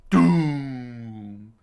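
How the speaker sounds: loud, long-drawn boom; booming sound effect. A narrator's reading. Narrator voices the giant's arrival, booming sound effect. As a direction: let it ring out and fall away.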